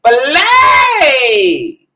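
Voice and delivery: high, loud, theatrical shout; shouted exclamation. Acted. Vlogger hyping herself up for viewers, loud high shout. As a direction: belt it out like a diva.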